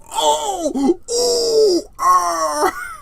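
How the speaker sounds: high pained yelps; mock fight grunts. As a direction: yelp like you're being beaten. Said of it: "making a lot of very funny noises".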